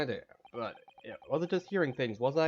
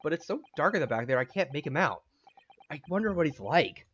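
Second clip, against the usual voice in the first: ordinary talk, nothing marked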